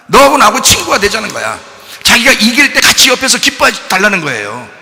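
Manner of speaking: loud, high-pitched preaching; forceful preaching. Real, not acted. Preacher urging people to pray while God fights, impassioned.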